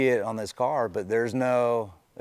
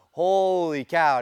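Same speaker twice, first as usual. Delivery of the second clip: drawn-out slow, pitched-up amazement; astonished; 9 semitones higher, 7 dB louder, much slower than usual. Retold or imitated. A driver recalling his shock at seeing the rival, slow and astonished. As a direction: stretch it out in disbelief. Retold reaction to rival alongside.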